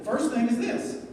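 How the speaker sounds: ordinary talk, nothing marked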